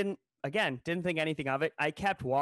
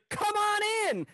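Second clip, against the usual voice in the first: very high, bright eager welcome; super excited; 5 dB louder than usual. Retold or imitated. Wienermobile girl welcoming him aboard, super excited and very high. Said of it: "Like, super excited".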